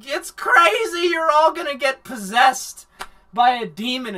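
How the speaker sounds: hammed-up doom prophecy; wild-eyed mock warning; mock alarm. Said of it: "he's like".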